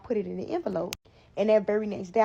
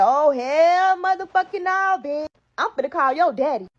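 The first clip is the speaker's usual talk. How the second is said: furious mom; 7 semitones higher, 7 dB louder than usual. Retold or imitated. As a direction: scream it like a mom who is done. Mom explodes at pregnancy news.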